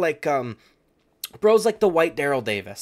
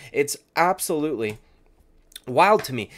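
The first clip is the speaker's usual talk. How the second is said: normal talking voice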